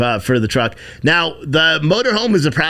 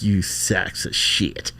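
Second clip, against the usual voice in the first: muttered insult; 5 semitones lower, 6 dB quieter, much slower than usual. Retold or imitated. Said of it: "I was like".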